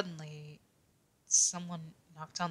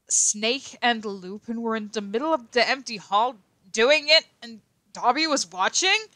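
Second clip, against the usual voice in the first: higher and louder, climbing in disbelief; shocked; 6 semitones higher, 9 dB louder than usual. A narrator's reading. Narrator reacting to finding the boys. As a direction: gasp it out, growing scandalized.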